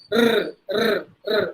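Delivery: "ர்" rolled with the tongue tip touching; trilled R. Shown as a demonstration. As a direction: trill each "ர்" as the sound to avoid. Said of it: "அந்த sound வந்துரும்". ("ர்" நாக்கு பட்டு உருளும் ஒலி)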